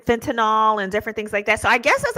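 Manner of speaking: ordinary talk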